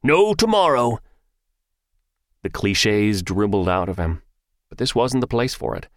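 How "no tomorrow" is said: high, loud hype shout. A narrator's reading. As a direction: shout it to pump up the team. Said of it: "way too loud".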